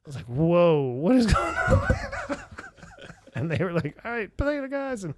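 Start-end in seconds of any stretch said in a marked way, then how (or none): 0.2-2.5 high, loud surprised laugh; what on earth, cracking up; laughing disbelief. Retold or imitated. Narrator reliving the shock at the bar, laughing high. 4.0-5.1 mock cheer. Regulars cheering.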